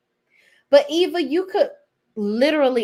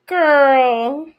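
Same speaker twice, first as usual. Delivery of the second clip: exasperated sigh; 5 semitones higher, 8 dB louder than usual. Real, not acted.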